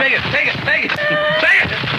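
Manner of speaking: high, insistent mock hawker; pushy flyer hander. Mimicking pushy flyer people.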